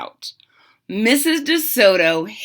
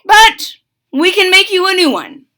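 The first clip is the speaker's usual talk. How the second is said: high, loud, upbeat promise; reassuring dentist; 10 semitones higher, 9 dB louder than usual. Acted. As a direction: cheer the patient up. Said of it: "Doctor DeSoto announced".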